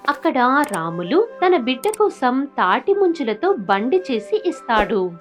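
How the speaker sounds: ordinary delivery, nothing marked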